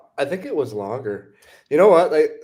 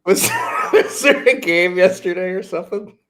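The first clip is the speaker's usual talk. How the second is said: laughing; 5 semitones higher, 5 dB louder than usual. Real, not acted. Teasing a colleague, laughing.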